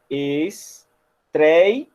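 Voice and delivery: ESTREIA syllable by syllable, drawn out; separado em sílabas. Shown as a demonstration. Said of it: "se separa assim". (estreia dito pausadamente, sílaba por sílaba)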